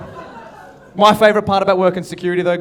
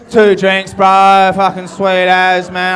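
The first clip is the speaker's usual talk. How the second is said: put-on drunk guy; 6 dB louder, much slower than usual. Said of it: "He's just".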